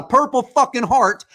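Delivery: normal talking voice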